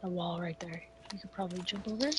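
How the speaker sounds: whispered callout; whispering. Real, not acted. Whispered tactical talk.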